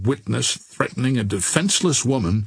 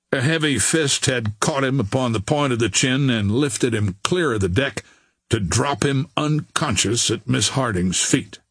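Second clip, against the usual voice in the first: ordinary delivery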